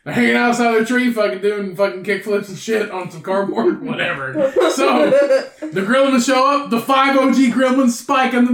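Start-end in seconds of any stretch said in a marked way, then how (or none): none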